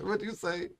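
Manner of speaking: normal talking voice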